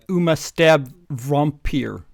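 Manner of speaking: slow, loud villain lisp. Acted. Co-host voicing the fanfic's villain, slow and loud. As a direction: ham up a lisping evil teacher. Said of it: "he said to me".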